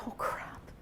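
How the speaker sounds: breathy whispered dismay; quiet private oh crap; whispered thought. Retold or imitated. Thought after shutting door.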